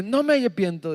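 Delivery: put-on a boy refusing to repent; stubborn child. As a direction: dig in your heels like a small boy. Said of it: "decía".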